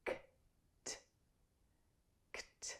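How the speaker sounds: K and t as bare voiceless clicks of sound; soft, separated K and t, then run together; isolated consonants. Shown as a demonstration. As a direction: release K, then t, without vowels. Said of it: "the last two consonant sounds".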